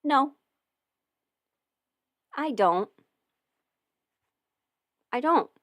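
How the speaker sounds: ordinary talk, nothing marked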